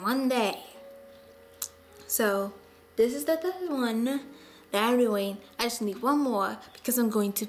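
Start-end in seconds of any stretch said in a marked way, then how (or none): none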